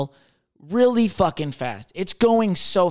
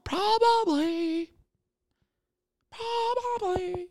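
very high, slow, softer creepy whisper-voice; high slow spooky croak; eerie put-on voice; 3 dB quieter, much slower than usual. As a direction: tease the grim answer in a spooky tone. Grim answer in a put-on voice.

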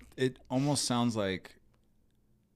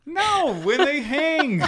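laughing; 10 dB louder, slower than usual. Real, not acted. Both cracking up laughing.

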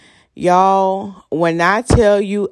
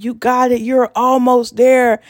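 encouraging; 6 semitones higher than usual. Retold or imitated. Man cheering her on.

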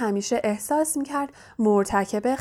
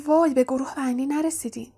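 ordinary delivery, nothing marked

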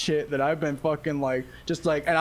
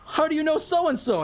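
high-pitched, bubbly party greeting; playful high-voiced impression of a flaky pal; Gio; 12 semitones higher than usual. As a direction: imitate a chirpy, oblivious friend. Imitates bubbly Gio greeting him.

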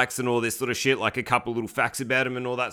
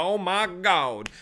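ordinary talk, nothing marked

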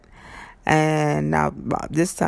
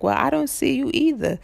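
much higher mock driver; imitating the driver's reply, pitched up; 10 semitones higher than usual. Driver answering the rider, mock higher voice.

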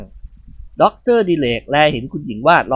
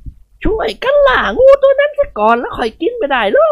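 put-on high, commanding old lady's voice; a matron rallying every servant to hunt the snake; bossy old lady; 4 dB louder than usual. Khun Ying Wad ordering the servants to kill the python, high and loud.

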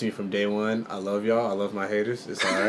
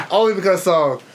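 laughing; 10 semitones higher, 9 dB louder, faster than usual. Real, not acted. Laughing at own purchase.

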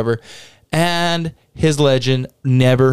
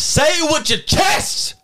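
shouting; 14 semitones higher, slower than usual. Real, not acted. Cheering his cohost on.

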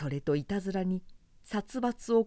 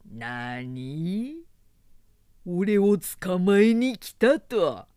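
put-on menacing drunk warrior; 6 dB louder, much slower than usual. A narrator's reading. Zhang Fei hears he is wanted.

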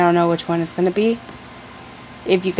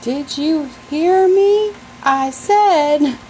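mock movie line; 8 semitones higher, much slower than usual. Quoting a shark movie line.

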